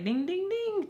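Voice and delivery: high sing-song ding, ding, ding; bell imitation. Reacting to his guess, mock bell ringing. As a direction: chime like a game-show bell.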